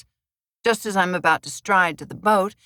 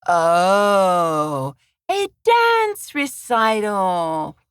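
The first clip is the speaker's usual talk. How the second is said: slow, drawn-out sing-song mockery; mocking sing-song; 5 dB louder, much slower than usual. A narrator's reading. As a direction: tease the words like a nursery rhyme. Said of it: "says in a sing-song voice".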